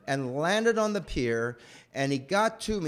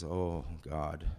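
dismayed sigh; 11 semitones lower, 8 dB quieter than usual. Retold or imitated. Dad watching the sunk car.

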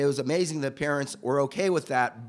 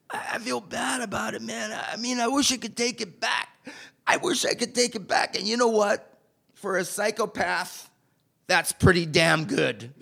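crying; 7 semitones higher than usual. Retold or imitated. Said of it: "He started crying".

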